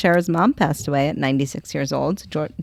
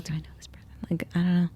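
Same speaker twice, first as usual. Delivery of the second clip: private whisper; 12 dB quieter, faster than usual. Retold or imitated. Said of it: "And I'm like".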